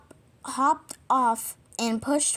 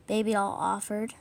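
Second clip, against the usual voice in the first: plain reading voice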